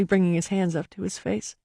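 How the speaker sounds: ordinary delivery, nothing marked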